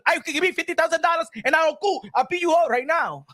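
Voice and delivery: playing a cocky Dominican boxer, Dominican accent. Vlogger imitating a Dominican fighter, hammed-up Dominican accent. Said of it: "I'm Dominican, I'm gonna say it like this".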